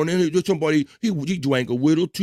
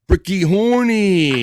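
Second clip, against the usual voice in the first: higher, louder, very slow drawn-out "Bricky Horny"; drawn-out mock announcement; 6 semitones higher, 6 dB louder, much slower than usual. Host christening Matt with a nickname, slow and higher. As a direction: stretch "Bricky Horny" like a ring announcer introducing a fighter.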